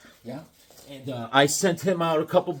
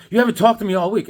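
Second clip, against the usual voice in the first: fast, loud, higher mock nagging; quick, raised-voice accusation, acted out; wife's complaint; 5 semitones higher, 7 dB louder, faster than usual. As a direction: imitate an aggrieved wife. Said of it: "She's like".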